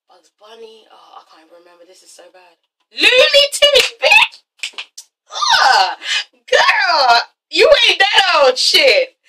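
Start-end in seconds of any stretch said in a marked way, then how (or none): 2.9-9.1 high-pitched, drawn-out shout; triumphant outburst. Real, not acted. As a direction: yell it as the forgotten name comes back. Remembers the show's name, triumphant.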